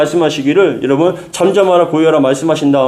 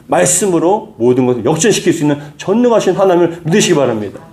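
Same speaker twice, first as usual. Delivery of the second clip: ordinary talk, nothing marked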